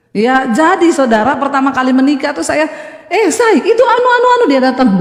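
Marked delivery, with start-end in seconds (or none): 3.1-4.4 high, rising, sharp-ending calls to her husband; high-ending Sulawesi tone. Retold or imitated. Wife calling husband in her high Sulawesi tone. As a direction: end every word up high, Sulawesi style. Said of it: "di belakang nadanya tinggi".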